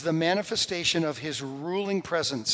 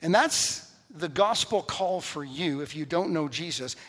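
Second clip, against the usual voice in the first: normal talking voice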